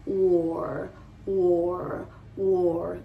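"war" rounded like "wo", R held gently; teacher model. Shown as a demonstration. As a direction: say "war" slowly for learners to repeat. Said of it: "Repitan conmigo".

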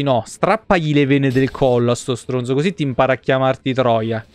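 ordinary delivery

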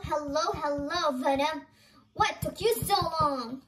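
ordinary delivery

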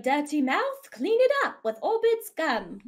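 chirpy Mary Poppins style ad pitch; British gum commercial parody. Acted. Parodying a gum commercial, high mock British ad voice. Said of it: "doing a parody of the Orbit's gum commercial".

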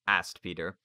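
usual speaking voice

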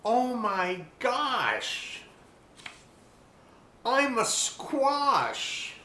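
high, very slow amazed exclaim; astonished discovery. A narrator's reading. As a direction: stretch out the wonder. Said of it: "realization exclaimed".

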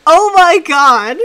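loud, high cry of exasperation; exasperated outcry. Real, not acted. Friend appalled by a mate pun, exasperated outcry. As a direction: groan it out at full volume, drawn out.

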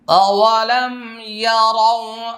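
slow, drawn-out sung tilawat; melodic Quran recitation. Shown as a demonstration. Teacher recites the verse to students in slow tilawat. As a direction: stretch each word in a high, chanting line.

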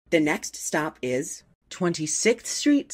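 usual speaking voice

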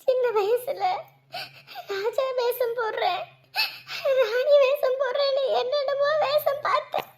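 anguished mother crying out; wailing grief. Acted. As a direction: wail brokenly over your child. Mother wails over hospitalised son.

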